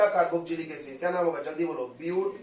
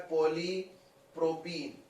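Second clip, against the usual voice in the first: slow repeat; 4 dB quieter, much slower than usual. Shown as a demonstration. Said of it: "अगला है".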